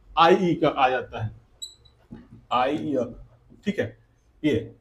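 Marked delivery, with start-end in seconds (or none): 0.1-3.0 आईई drawn out slowly, low and steady; slow teacher demo. Shown as a demonstration. Teacher slowly sounds out आईई for young pupils. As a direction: stretch आईई so the class hears it. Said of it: "वो हमारा क्या आ जाता है?".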